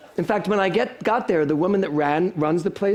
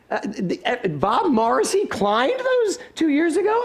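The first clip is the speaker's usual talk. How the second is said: shocked disbelief; 10 semitones higher, slower than usual. Retold or imitated. Shocked at who climbed.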